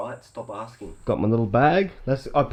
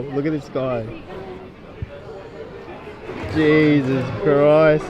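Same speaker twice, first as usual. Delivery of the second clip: ordinary talk, nothing marked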